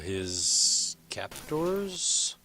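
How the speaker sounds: very slow, drawn-out words; slowly, hesitantly. Acted. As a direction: stretch out the word with misgiving. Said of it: "he kind of says slowly".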